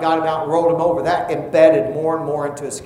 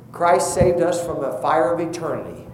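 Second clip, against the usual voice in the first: ordinary delivery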